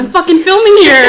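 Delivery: loud, high laughing yell; playful yell. Real, not acted. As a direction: yell it at your friend in fun.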